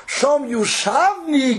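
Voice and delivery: Yushavni drawn out very slowly; stress on Yushavni. Shown as a demonstration. Rabbi stresses sitting by the rivers, drawing out Yushavni slowly. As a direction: stretch Yushavni, slow and lifted. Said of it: "If you have Yeshiva Das".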